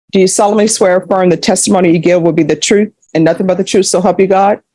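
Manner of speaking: fast, rattled-off legal formula; rote court oath. Real, not acted. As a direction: run through it like you've said it a thousand times. Judge administers oath again.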